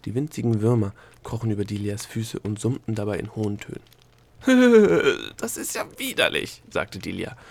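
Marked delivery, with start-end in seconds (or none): 4.4-5.3 loud, high, falling squeal; squeal of disgust. Acted. Delia disgusted by crawling worms. 5.4-6.6 high, squeamish revulsion; disgusted. Acted. Delia disgusted by crawling worms.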